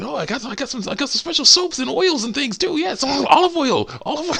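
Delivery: eager, very high falsetto patter; coaxing walrus cook. Retold or imitated. Walrus tempting Crash with bath oils, eager falsetto. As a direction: pile on the offers in a squeaky comic voice. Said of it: "doing the same thing with this walrus".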